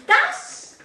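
Does leaps high and loud; teacher launching a question; high exclamatory does. Shown as a demonstration. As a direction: pop the question word up. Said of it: "teacher models the question".

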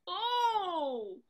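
high, drawn-out Southern belle greeting; put-on Southern accent. Welcoming Monsieur Caron at the door, stagey Southern accent. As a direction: lay on a slow Southern accent. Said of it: "doing that stupid Southern accent".

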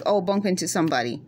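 ordinary talk, nothing marked

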